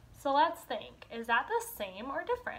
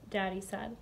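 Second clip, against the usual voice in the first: ordinary talk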